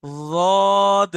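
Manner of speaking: रॉद said with a thick, heavy Arabic d; demo of Arabic letter. Shown as a demonstration. Teacher showing students the distinct letter sound, loud. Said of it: "ये sound ही अलग है बिल्कुल".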